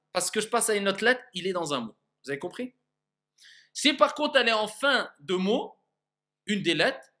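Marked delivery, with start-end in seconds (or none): none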